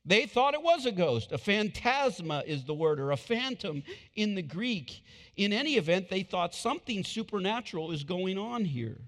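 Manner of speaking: high, loud preaching; preacher explaining the phantom; fiery preaching. Acted. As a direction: drive it home to the crowd. Preaching at full force.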